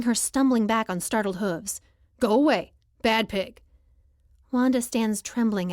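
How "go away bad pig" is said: firm, louder scolding of an animal; sharp, emphatic telling-off; no-nonsense command. A narrator's reading. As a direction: shoo the pig away sternly. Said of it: "another no-nonsense command".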